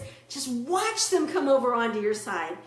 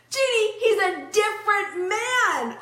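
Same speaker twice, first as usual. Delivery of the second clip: excited quote; 9 semitones higher, 5 dB louder than usual. Retold or imitated. Wife reports good news excitedly.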